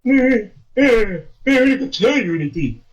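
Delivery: high, sing-song mock dismissal; childish mimicking tone; mocking retort. Mocking retold dismissive quote.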